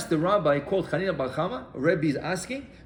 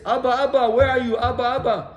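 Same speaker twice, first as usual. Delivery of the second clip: raised, higher calling voice; 6 semitones higher, 4 dB louder than usual. Acted. Son searching the cemetery for his late father, calling out. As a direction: call out for your father as if searching for him.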